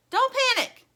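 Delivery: very high, loud urgent yell. Acted. Plastic yells reassurance to stuck Lumpy. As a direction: shout it to calm him down. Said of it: "yells Plastic".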